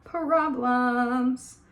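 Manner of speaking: drawn out problems in a strange put-on voice; odd voiced repeat. Real, not acted. Vlogger repeating her verdict, odd drawn-out voice. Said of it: "you have problems".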